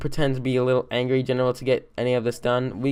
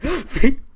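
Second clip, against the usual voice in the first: short high squealing laugh; high burst of laughter; 12 semitones higher, 6 dB louder than usual. Real, not acted. Laughing at the imagined scolding, high laugh.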